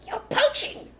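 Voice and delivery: very high, loud shriek. A narrator's reading. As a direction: shriek the accusation. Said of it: "shrieked Rat".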